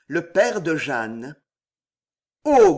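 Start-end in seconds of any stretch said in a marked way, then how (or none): 2.4-2.8 loud growled "oh", higher than usual; growled exclamation. A narrator's reading. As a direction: burst out with a growl. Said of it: "gronda-t-il". (grondement soudain et fort)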